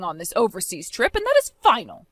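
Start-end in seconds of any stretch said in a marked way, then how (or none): 1.1-2.1 high final decree. Acted. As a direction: slam the door on the argument. Mother-in-law refuses to cancel trip.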